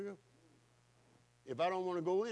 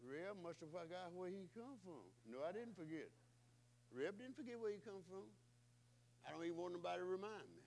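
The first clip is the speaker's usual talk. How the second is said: much softer, offhand; quiet aside; 13 dB quieter than usual. Acted. Preacher teasing himself, quiet aside.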